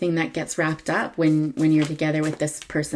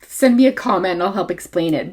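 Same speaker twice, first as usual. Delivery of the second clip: high, loud sarcastic tease; mock-helpful sarcasm, pitched up; 3 semitones higher, 6 dB louder than usual. Real, not acted. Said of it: "that's my air sarcasm there".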